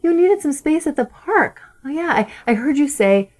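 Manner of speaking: gentle parent. Retold or imitated. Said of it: "follow up with her later and say".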